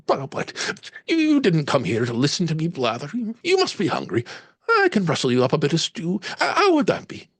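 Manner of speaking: high, flustered Irish lilt with a laugh; Irish landlady voice. Acted. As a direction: bustle and offer stew in an Irish accent. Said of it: "slight Irish lilt".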